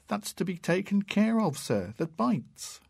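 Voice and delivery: a frightened schoolboy answering; boy's voice. A narrator's reading.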